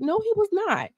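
high, sharp no; indignant denial. Real, not acted. Vlogger defending Charlemagne, indignant denial. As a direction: shut the claim down, indignant.

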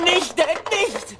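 high, drawn-out desperate plea; panicked pleading. Acted. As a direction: beg him in panic. Son begging father in panic.

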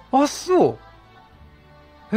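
usual speaking voice

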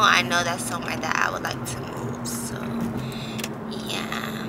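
soft unvoiced whisper. Real, not acted. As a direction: drop to a whisper. Aside to viewers while driving.

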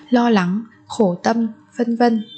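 usual speaking voice

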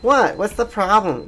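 high-pitched imitation of a clueless girl; mock oblivious girl. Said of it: "And she's just like".

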